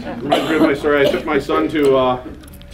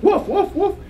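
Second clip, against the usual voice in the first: mock dog bark. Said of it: "She's like".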